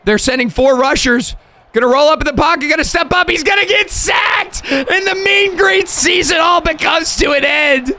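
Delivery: fast, rising sportscaster call building to a shout; live play-by-play. Acted. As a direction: call the final drive like a broadcaster. Calls the game play-by-play.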